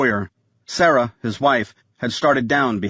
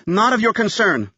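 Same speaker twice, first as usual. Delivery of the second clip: high, curt snapped reply; 9 semitones higher than usual. A narrator's reading. Wife shutting down the nanny, curt snap. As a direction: cut her off sharply. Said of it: "Sarah snapped".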